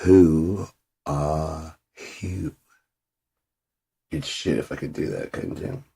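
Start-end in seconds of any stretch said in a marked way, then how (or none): none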